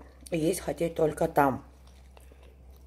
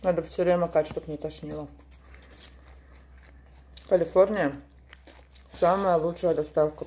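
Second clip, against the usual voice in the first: words muffled by food; talking while chewing. Real, not acted. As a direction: mouth partly full. Seen 0: Speaker's lips look pressed and moving between words; roll in chopsticks.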